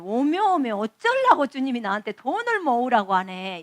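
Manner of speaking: Jeolla dialect. Retold or imitated. Preacher retelling her surprised reply to the Lord in Jeolla dialect. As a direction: exclaim 오메 오메 in broad Jeolla dialect. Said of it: "전라도 말로".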